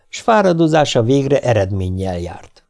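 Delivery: ordinary delivery, nothing marked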